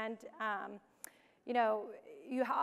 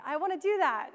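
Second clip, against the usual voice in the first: higher, louder keen volunteering; eager mock quote; 5 semitones higher, 5 dB louder than usual. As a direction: sound like someone diving right in. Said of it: "this is my attitude".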